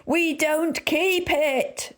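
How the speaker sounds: high, slow, drawn-out shout; exasperated clerk shouting slowly. A narrator's reading. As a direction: spell it out to a deaf man, high and slow. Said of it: "shouted the assistant".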